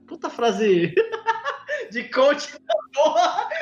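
laughing through the words; cracking up at himself. Real, not acted. Laughing at own coach phrase.

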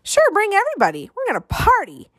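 put-on high, fast, gleeful kid voice; excited boy. A narrator's reading. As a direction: whoop it up about the party. Excited boy welcoming any guest.

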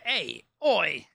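light New Zealand "Hey, oi"; New Zealand accent. Retold or imitated. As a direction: toss off a Kiwi "oi". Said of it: "The New Zealand accent".

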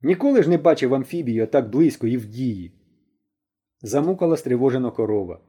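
plain reading voice

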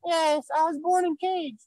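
put-on high, childlike puppet voice; squeaky little baby voice; baby gorilla voice.

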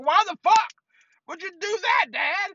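high, incredulous outburst; appalled protest. Retold or imitated. Son protesting to father in story.

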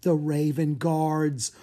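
plain reading voice

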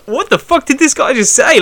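high, slowed-down amused disbelief; incredulous. Retold or imitated. Fighter and coach staring at the opponent, incredulous. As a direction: imitate being stunned by an absurd remark.

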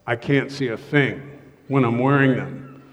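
usual speaking voice

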